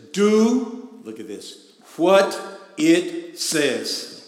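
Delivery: loud, slow emphatic command; preaching. Acted. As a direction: land each word.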